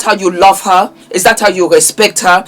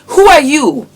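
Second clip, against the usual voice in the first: scornful; 9 semitones higher than usual. Acted. Scornful challenge repeated louder.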